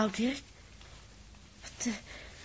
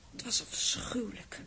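horrified whisper; 4 dB quieter than usual. Acted. Recalling the horror.

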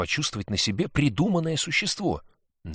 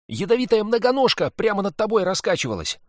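put-on higher, louder excited boy; boy's voice; 12 semitones higher, 7 dB louder than usual. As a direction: play a cheeky lying boy. Narrator voices the boy Sid.

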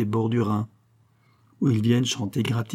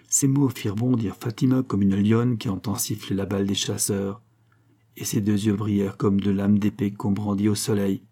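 ordinary delivery, nothing marked

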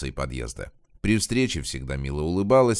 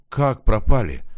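loud, higher-pitched alarmed shout; 4 semitones higher, 7 dB louder than usual. A narrator's reading. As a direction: cry out in disbelief. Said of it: "закричала Зинаида".

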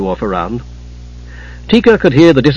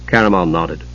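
plain reading voice

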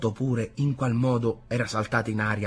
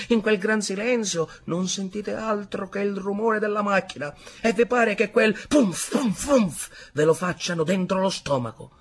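higher-pitched, eager war tale; animated soldier storyteller; 9 semitones higher, 5 dB louder than usual. A narrator's reading. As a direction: relive the battle for the listeners. Soldier character tells his battle tale.

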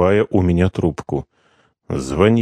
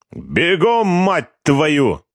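high hurry-up yell; yelled order; 9 semitones higher, much slower than usual. Acted. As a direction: chase him off running. Corporal yells order.

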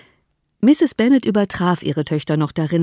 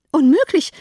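higher, louder outcry of a flustered mother; protesting cry; 9 semitones higher, 6 dB louder than usual. A narrator's reading. Mother refuses her husband's suggestion, higher outcry. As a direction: burst out against the idea.

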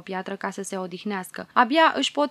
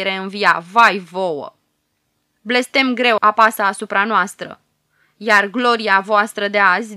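groaning, tearful; 7 dB louder than usual. Acted. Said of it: "gemu bătrânul și lacrimi grele".

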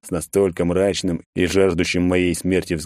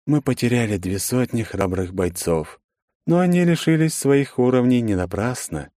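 grave leader voice; 5 semitones higher than usual. A narrator's reading. Dark one speaks.

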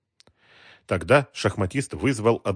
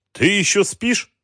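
mocking boss voice; 12 semitones higher, 6 dB louder, slower than usual. A narrator's reading.

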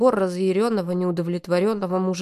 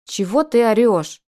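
louder indignant snap; mildly raised, indignant; 3 semitones higher, 6 dB louder than usual. Acted. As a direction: indignantly call him out for yelling. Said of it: "возмутилась я".

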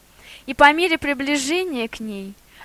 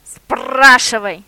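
slow, loud growling command; drawn-out dog growl; 8 dB louder, much slower than usual. A narrator's reading. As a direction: growl it out like a big guard dog. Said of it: "прорычал шеф".

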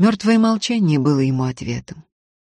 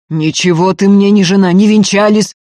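loud, raised denial; heated, forceful dismissal; angry retort; 5 semitones higher, 10 dB louder than usual. A narrator's reading. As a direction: hurl it back in a quarrel. Ефим орёт в ссоре с Устиньей.

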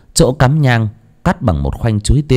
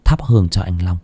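ordinary delivery

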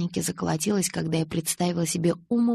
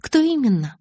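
higher, louder pressing question; insistent; 9 semitones higher, 6 dB louder than usual. A narrator's reading. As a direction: refuse to drop it. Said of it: "не унималась я".

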